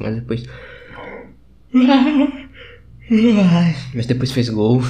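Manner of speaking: talking through a yawn; words stretched by a yawn. Real, not acted. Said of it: "Perdão aí pelo bocejo".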